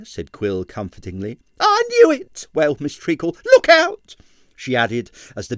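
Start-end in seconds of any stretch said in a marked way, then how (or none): 1.6-4.2 shrill, much higher, loud alarm; landlady panicking in the passage; panicked landlady. A narrator's reading. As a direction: flap in a high panic.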